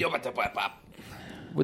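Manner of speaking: far-off babbling alien sounds; garbled alien talk. Pretend. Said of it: "that's not an alien talk".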